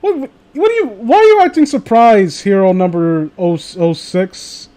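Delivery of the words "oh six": ordinary delivery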